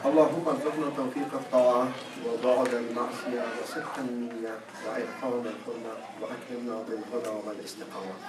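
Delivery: Arabic supplication. Acted. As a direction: rattle off the Arabic prayer in one breath.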